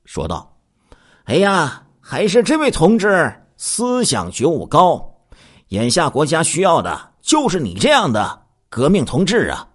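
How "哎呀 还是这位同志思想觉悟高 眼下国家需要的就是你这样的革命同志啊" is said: high-pitched, effusive praise; butter up the commander, voice lifted high; flattering. A narrator's reading. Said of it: "给他戴了一顶高帽子". (吹捧奉承的语气)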